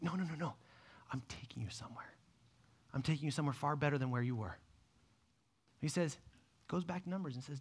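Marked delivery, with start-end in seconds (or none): 0.0-4.6 gentle hushed reassurance. Retold or imitated. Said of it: "And God's like".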